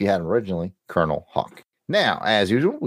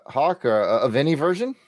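normal talking voice

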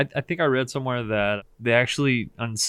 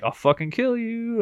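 dog voice; 11 semitones higher than usual. Retold or imitated. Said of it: "he's like".

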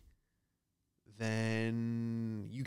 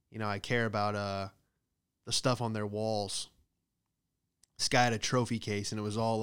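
normal talking voice

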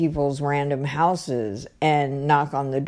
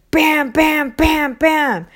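loud, high-pitched rapid bams; banging sound acted out in words; imitated door banging; 9 semitones higher, 5 dB louder, slower than usual. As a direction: punch each bam like a fist pounding a door. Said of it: "banging on my door".

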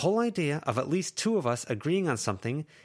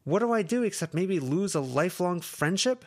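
high, louder, pained; upset outburst; 11 semitones higher, 4 dB louder than usual. Acted. Lucy to Verona, upset outburst. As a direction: pour out the fear at her. Lucy venting fear at friend.

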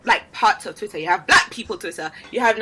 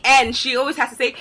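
usual speaking voice

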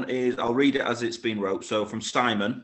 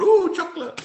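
excited squeal; 6 dB louder than usual. Real, not acted. Host reacts to chocolate question.

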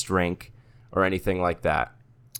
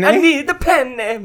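panicked Italian cartoon voice, high and loud; slow, frantic hammed-up Italian plea; Mario; 5 dB louder, slower than usual. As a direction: beg as if the killer is coming. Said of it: "my entire impression from Mario".

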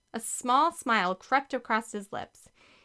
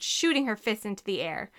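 ordinary delivery, nothing marked

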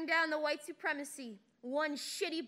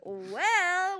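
loud; 4 semitones higher, 6 dB louder than usual. Acted. Loud drawn-out well.

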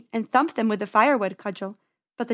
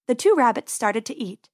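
usual speaking voice

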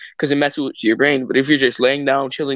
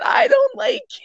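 much higher, laughing mock wail; mocking his crying. Friend teasing the host about crying, high laughing mock wail. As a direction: mimic your friend's sobbing through laughter. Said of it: "you said".

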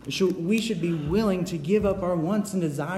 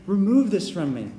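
loud, higher pleading cry; pleading Jesus; 6 semitones higher, 6 dB louder than usual. Acted. Jesus begging God before Golgotha, loud plea. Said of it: "He's saying".

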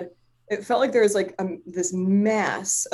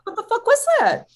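very high, louder, squealed disbelief; flabbergasted; 5 dB louder than usual. Retold or imitated. Client asking healer what happened, flabbergasted. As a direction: retell total bewilderment, squealing.

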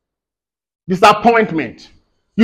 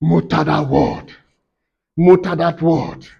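low, soft mutter; quiet, low-pitched muttered instruction; 6 semitones lower, 6 dB quieter than usual. Shown as a demonstration. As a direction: mutter it low, as if meditating on a word. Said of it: "Meditate means to mutter".

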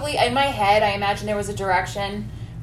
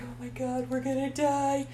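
softer, breathy-faint fearful gasp; mock terrified; 3 semitones higher, 9 dB quieter than usual. Imitating Pepper, scared after the gunshots, softer mock terror. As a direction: squeak it out in panic. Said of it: "Pepper's like".

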